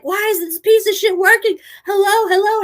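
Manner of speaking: high, loud frantic yelling; panic mode. Retold or imitated. Driver hitting a dead radio handset, panicked and loud. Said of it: "in panic mode".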